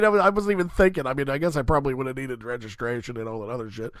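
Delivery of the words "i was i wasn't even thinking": ordinary talk, nothing marked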